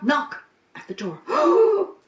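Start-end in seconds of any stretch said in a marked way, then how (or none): 1.3-2.0 high, loud startled gasp of oh. Acted. Goat kids startled by a knock at the door, high gasp. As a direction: jump at the knock.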